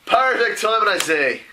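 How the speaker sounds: loud, high exclamation; hearty character greeting. Acted. Character hails the door sliding open, loud hearty greeting.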